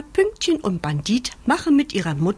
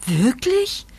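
higher, rising eager question; hopeful; 5 semitones higher than usual. A narrator's reading. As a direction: ask with sudden hope. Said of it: "fragt Bandit voller Hoffnung".

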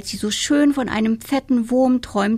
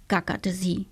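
ordinary delivery, nothing marked

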